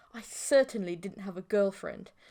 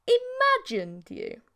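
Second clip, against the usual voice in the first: exasperated; 14 semitones higher, 6 dB louder, slower than usual. A narrator's reading. Narrator protesting.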